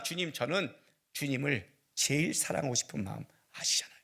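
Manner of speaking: soft whisper of a private prayer. Acted. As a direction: breathe it to the Lord like a secret. Intimate confession to the Lord.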